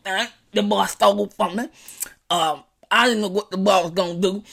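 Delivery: put-on humble country-boy act. Shown as a demonstration. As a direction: stammer out a meek excuse to the officer. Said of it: "that's how you better go in there".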